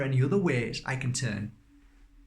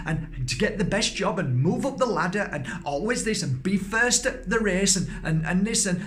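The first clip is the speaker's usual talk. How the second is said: higher, louder sing-song mockery of school rules; mocking list of rules; 6 semitones higher, 3 dB louder than usual. Speaker mocking what children are told at school. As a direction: rattle off the rules as if they're nonsense.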